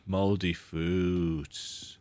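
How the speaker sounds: ordinary delivery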